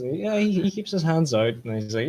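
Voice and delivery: ordinary delivery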